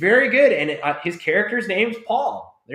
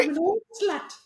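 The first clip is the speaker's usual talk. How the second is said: high, softer squeaky granny; grandma voice; 4 dB quieter, faster than usual. Retold or imitated. Imitating jackass grandma Gloria, high granny voice. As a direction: imitate a little old grandma, pitched way up. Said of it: "the hint of the grandma voice".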